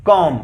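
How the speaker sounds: कॉम with b unspoken; कॉम said ending on m, no b sound; silent b. Shown as a demonstration. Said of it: "not कॉम्ब". (कॉम बोलें, बी silent)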